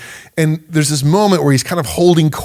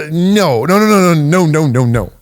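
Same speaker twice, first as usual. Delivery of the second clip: rapid string of no's, half laughing; flustered laughing protest; exasperated no's; 3 semitones higher than usual. Real, not acted. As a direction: shake it off with a flurry of no's. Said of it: "I kinda get a little bit".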